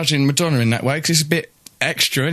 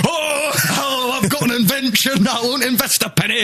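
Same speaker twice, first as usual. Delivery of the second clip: hammed-up Scottish mogul, pitched up; mocking Bannatyne; 7 semitones higher than usual. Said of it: "I mocked Bannatyne".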